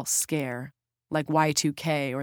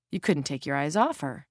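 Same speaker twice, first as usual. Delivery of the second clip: usual speaking voice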